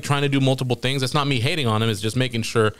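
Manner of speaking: ordinary delivery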